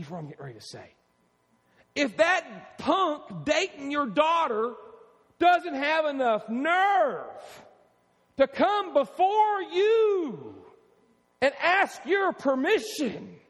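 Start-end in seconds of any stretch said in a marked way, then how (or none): 1.9-13.4 slow, loud and high, every phrase hammered; stern warning. Acted. Pastor warning dads about their daughters' boyfriends, slow loud stern. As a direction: lay down the law to the boyfriends.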